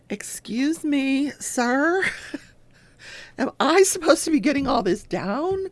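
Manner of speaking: high, hesitant, half-laughing court reporter; a light take on a nervous stenographer; flustered court reporter. Retold or imitated. Court reporter asking the judge, lightly mimicked and high. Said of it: "the court reporter's like".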